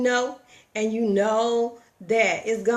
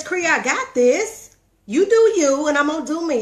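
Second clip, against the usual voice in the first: God's firm answer; 5 semitones higher, 5 dB louder than usual. Retold or imitated. Voices God's firm answer to her.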